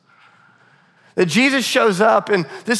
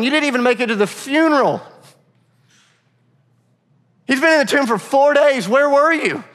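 higher-pitched, pleading accusation with long pauses; acted grief turning into a pained complaint; anguished reproach; 6 semitones higher than usual. Grieving sister confronts Jesus over missing the funeral, anguished reproach.

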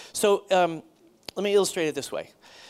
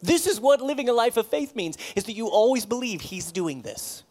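rising, louder preaching; 5 semitones higher, 5 dB louder than usual. Real, not acted. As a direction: build to a fervent close. Preaching at full force.

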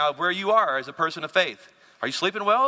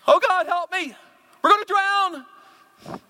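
high, loud cry for help; hammed-up panic, pitch leaping up; panicked prayer; 13 semitones higher, 5 dB louder, slower than usual. A panicked believer praying in the storm, mock wail. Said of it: "most of us pray when we're panicked".

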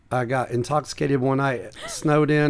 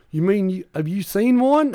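amazed earnest tourist; 6 semitones higher, 4 dB louder than usual. Retold or imitated. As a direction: imitate a gullible tourist, pitch climbing in wonder. Imitating tourist's earnest, amazed question.

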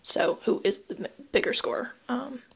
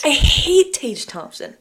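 slow, higher, louder insistence; emphatic dislike; 8 semitones higher, 8 dB louder, slower than usual. Real, not acted. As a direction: lean hard on hate. Repeats her dislike emphatically.

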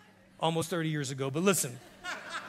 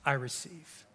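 soft prayer model; 9 semitones lower, 13 dB quieter than usual. Shown as a demonstration. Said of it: "All you have to do is say".

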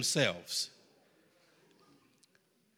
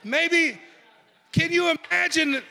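excited shout; 10 semitones higher than usual. Real, not acted. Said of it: "preacher exclaiming in amazement".

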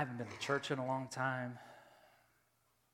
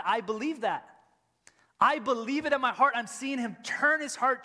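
much higher, louder passionate preach; full force preaching; 12 semitones higher, 10 dB louder than usual. Acted. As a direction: preach it with conviction.

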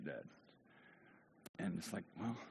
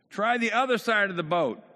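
loud high shouted instruction; yelling out; 10 semitones higher, 10 dB louder than usual. Acted. Man on shore tells the fishermen where to fish, yelling. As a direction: holler it out over the water. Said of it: "yells out".

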